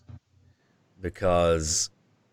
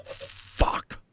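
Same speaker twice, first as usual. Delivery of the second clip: whispered demonic curse; breathy voiceless demon swearing; demon's voice. Retold or imitated. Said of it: "the voice is like".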